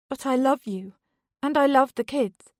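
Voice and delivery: wife begs her husband over the phone, pleading. Acted. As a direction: beg him to believe you. Beth begging her husband.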